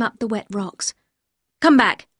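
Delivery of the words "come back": sharp, louder command; abrupt call to return. Acted. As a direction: order them back firmly. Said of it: "Maxim said sharply".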